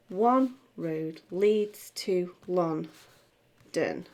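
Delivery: very slow, one leaned on and falling; slow stressed line. Shown as a demonstration. Teacher shows how the line falls in six eight: slow stressed line. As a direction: draw out each beat of the line. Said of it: "That's how it's gonna fall".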